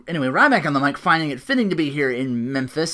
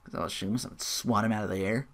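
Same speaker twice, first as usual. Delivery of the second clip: usual speaking voice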